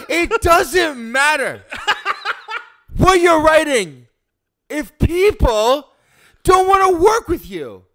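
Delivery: high, loud, slow and laughing; milk every word like a diva; grand dramatic delivery. Pretend. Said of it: "Say the first line".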